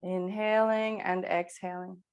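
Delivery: usual speaking voice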